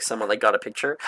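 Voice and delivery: ordinary talk, nothing marked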